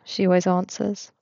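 usual speaking voice

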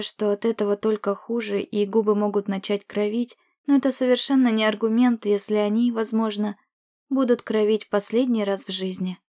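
ordinary delivery

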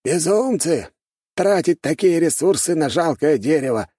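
high-pitched, indignant elder; grumbling old man. A narrator's reading. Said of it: "проворчал старик в чёрном".

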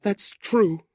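high, grieving woman's voice; sorrowful widow. A narrator's reading. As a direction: concede it sadly, pitched high.

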